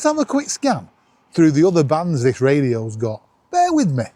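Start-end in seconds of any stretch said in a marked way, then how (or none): none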